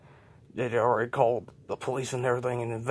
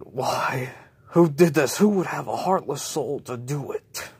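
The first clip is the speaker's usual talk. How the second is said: grieving outcry; 3 semitones higher, 7 dB louder than usual. Acted. Grieving husband at funeral.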